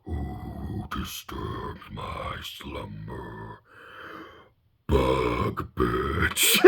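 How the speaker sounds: deep, slow, grinding stone whisper; Cave of Wonders voice. Retold or imitated. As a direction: rumble it like a cavern beast. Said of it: "it just sounded like the Cave of Wonders".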